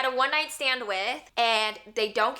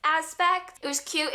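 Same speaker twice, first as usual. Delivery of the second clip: ordinary delivery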